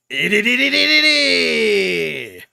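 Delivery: slow, loud, high rapid-syllable cry; loud high battle chant; imitated attack cry. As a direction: mimic the attack shout.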